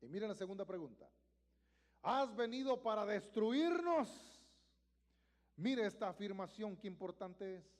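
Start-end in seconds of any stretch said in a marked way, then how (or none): 2.0-4.3 loud, slow demon question; loud drawn-out demonic cry; demon shouting. Acted. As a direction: hurl the question like a cornered spirit. Demon voiced in Bible reading.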